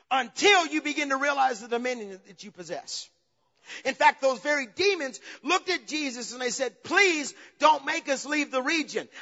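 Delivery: high, loud pulpit cry; impassioned preaching. Acted. Preacher tells congregation how demons begged Jesus, impassioned preaching. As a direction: drive each phrase home forcefully.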